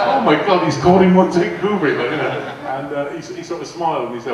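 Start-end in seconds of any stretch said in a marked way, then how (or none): 0.1-2.0 high, loud, laughing disbelief; shocked crew gasp. Retold or imitated. Film crew reacting in shock to the joke, laughing loud. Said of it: "everybody went".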